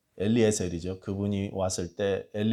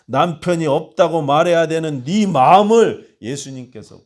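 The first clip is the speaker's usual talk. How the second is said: usual speaking voice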